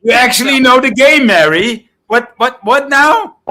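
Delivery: stunned at a friend who knows the game; surprised outburst. Real, not acted. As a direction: blurt it out in disbelief.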